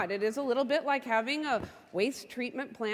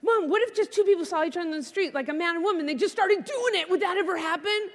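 put-on higher, insistent kid questioning; curious child; 4 semitones higher, 4 dB louder than usual. Daughter to mom while driving home, eagerly curious. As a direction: press mom like an inquisitive little girl.

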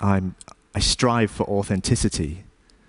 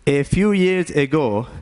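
put-on Indian-accented English, lifted a little; put-on Indian accent, higher than usual; 4 semitones higher than usual. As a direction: begin the story in an Indian accent. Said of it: "have an Indian accent anymore".

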